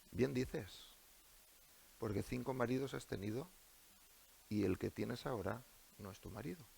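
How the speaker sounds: soft, meek answer to the woman; meek reply. Acted. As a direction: say it softly, without reproach. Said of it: "Le responde con mansedumbre".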